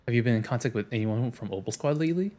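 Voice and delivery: normal talking voice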